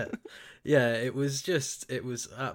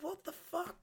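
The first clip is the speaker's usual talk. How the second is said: incredulous; 11 dB quieter than usual. Real, not acted. Incredulous at plot twist.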